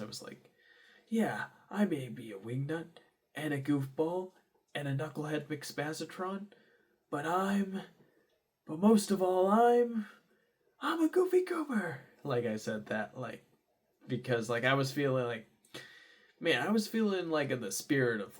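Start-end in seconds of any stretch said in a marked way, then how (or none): 1.1-12.0 hammed-up movie speech, climbing higher and louder; SpongeBob reference. Worker quoting SpongeBob movie to boss, hammed-up and rousing. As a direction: build from playful admission to high, triumphant declaration. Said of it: "making a little SpongeBob reference".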